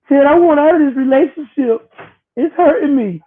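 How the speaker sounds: high put-on woman's voice, pleading; hammed-up plea to leave him; mock pleading wife. Mocking Whoopi as she asks Ted to let her go, high and pleading.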